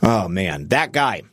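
ordinary delivery

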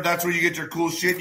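ordinary talk, nothing marked

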